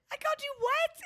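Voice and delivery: high, loud disbelieving squeal; retelling her outraged reaction; shocked question. Retold or imitated. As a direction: ask it in total disbelief. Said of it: "I said".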